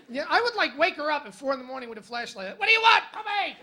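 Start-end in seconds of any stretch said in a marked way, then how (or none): 2.6-3.6 much higher and louder, odd voice; mock Rocky-style growl. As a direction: bellow it like a punchy boxer. Waking lady at 4am.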